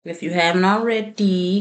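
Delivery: usual speaking voice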